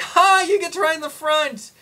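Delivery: loud, very high gleeful taunt; imitating an excited boy shouting; crowing little boy. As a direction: yell it like a triumphant kid. Voices the boy crowing at her.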